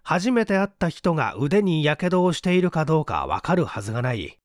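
ordinary delivery, nothing marked

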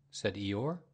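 ordinary delivery

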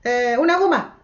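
high bright model answer; demo answer. Shown as a demonstration. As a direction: show the kids how to answer, up high. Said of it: "tienen que decir".

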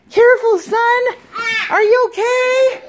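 high, loud fussing; playful mock worry. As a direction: fuss over him like a parent. Mock worry over toy.

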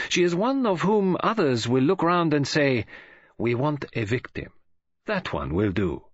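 put-on lightly accented, measured detective; grave foreign detective. Detective sums up the girl as a born victim, grave. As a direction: pronounce each judgement with weight, leaning on NOT.

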